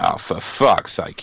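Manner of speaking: low-key, drawn-out exasperated aside; retold slow exasperated sigh of words; weary exasperation. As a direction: drag it out as if fed up. Retelling own exasperated inner reaction.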